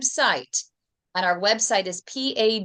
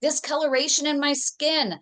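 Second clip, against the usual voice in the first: patient instructing doctor; 6 semitones higher than usual. Acted. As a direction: spell out each request to the doctor, pitched up. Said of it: "That means".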